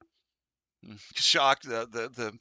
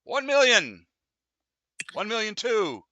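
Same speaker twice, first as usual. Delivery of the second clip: much higher, louder bidding call; big bid call; 9 semitones higher, 10 dB louder, slower than usual. Acted. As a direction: call out the million with excitement. Bidding climbs to a million.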